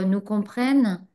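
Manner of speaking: usual speaking voice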